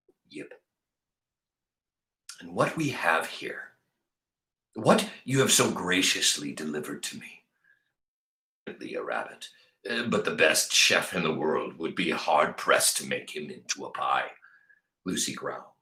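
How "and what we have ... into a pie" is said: lower, softer, unhurried man's voice; lightly voiced big man. Bull greets the dog who brought him a rabbit, in a low mild voice. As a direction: speak like a gentle big tramp.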